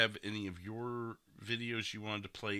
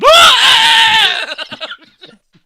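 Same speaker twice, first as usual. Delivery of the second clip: bursts into a loud, high belly laugh; a howling laugh that breaks high; big shouted laugh; 30 dB louder than usual. Real, not acted. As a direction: cracks up hard at his own bit. Cracking up at his own joke.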